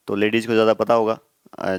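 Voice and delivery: usual speaking voice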